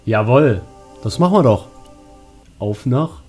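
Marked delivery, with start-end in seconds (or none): none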